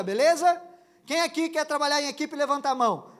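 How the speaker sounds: loud and high, rallying a crowd; playful rally call. Acted. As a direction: shout like a camp leader. Playful speech to organs. (discurso de animador, alto e brincalhão)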